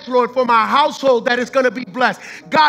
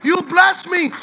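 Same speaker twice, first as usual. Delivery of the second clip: Hannah crying out to Jesus; 5 semitones higher than usual. Acted. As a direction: shout her thanks to Jesus with a broken voice. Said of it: "she's saying".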